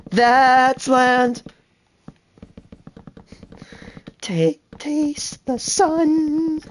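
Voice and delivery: high, slow and awed; hammed-up epic narration; dramatic documentary narrator. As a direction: narrate evolution with wonder. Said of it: "I love doing that".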